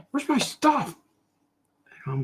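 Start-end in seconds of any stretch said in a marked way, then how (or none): 0.1-1.0 high-pitched mock complaint; angry customer. Customer waiting on the artist's order, high mock-angry voice. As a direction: put on a squeaky, demanding customer voice. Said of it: "People are angry with me".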